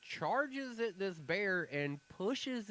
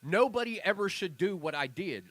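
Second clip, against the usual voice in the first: emphatic and louder; adamant warning; 3 semitones higher, 6 dB louder than usual. Retold or imitated. Retelling the girl's adamant plea to the news, emphatic. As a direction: insist hard, a warning to everyone. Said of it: "She was like".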